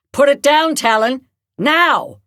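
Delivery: loud, high command to drop the gun; shouting. Acted. Edna yelling at Talon to drop it, a loud shout. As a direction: bark the order at full volume. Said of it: "she shouted".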